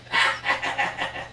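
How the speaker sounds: low, airy maniacal laugh; slow staccato madman's cackle; villain cackle. Acted. Joker impression ends in villain laugh.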